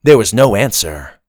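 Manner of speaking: plain reading voice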